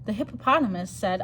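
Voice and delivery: plain reading voice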